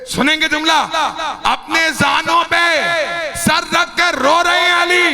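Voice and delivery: mourning preacher in full lament; majlis lament. Acted. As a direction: cry out the grief, stretching each phrase.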